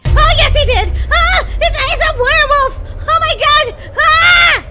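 high, loud terrified shrieks; full-tilt make-believe horror; screaming panic. Pretend. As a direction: scream and stammer in pretend terror. Werewolf has seen her.

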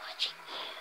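hushed sinister whisper; creepy whisper. Pretend. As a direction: breathe it like a stalker. Creepy aside to camera.

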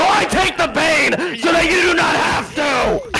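high, shouted self-sacrificing declaration; grandiose rant. Real, not acted. Self-styled Pathfinder lecturing a friend, grandiose rant. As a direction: proclaim it like a martyr.

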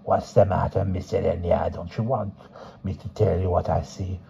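put-on soft old fortune teller; old woman voice.

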